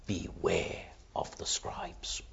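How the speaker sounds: hushed, secretive whisper of a warning. Shown as a demonstration. Preacher whispering the warning to the congregation. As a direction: breathe it like a secret. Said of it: "He says it loudly".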